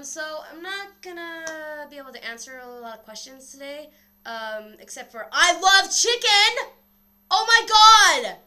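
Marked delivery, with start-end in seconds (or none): 5.3-8.4 high, drawn-out yell; sudden loud, high-pitched outburst; excited shouting. Real, not acted. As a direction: burst out hollering at full volume. Sudden outburst mid-sentence about a video.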